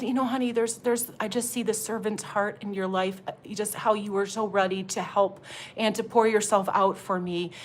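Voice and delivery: soft, tender retold blessing; calm, hushed ministering voice; calm prophecy. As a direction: gently speak encouragement over him. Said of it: "I'm a little more calm".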